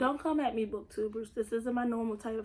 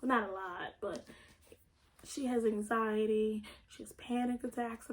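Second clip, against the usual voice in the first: normal talking voice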